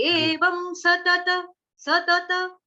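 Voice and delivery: very slow सतत, both त clear; slow correcting demo; double ta. Shown as a demonstration. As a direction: stretch सतत with two त. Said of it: "सतत दो त है".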